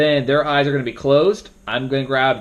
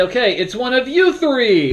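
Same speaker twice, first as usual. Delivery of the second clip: 1 teasing moderator; 9 semitones higher, 4 dB louder than usual. Shown as a demonstration.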